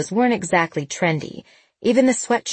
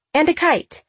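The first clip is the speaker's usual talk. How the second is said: put-on high young girl voice; lightly voiced teen girl; 10 semitones higher than usual. A narrator's reading. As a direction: add it like a kid. Voiced daughter line.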